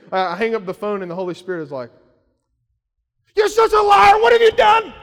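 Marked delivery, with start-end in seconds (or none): 3.4-4.9 high, loud shouted accusation; mock accusing shout. Mock Holy Spirit scolding him after the phone call, accusing shout. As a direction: yell it as a hammed-up scolding. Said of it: "he didn't do that. It was like this gentle".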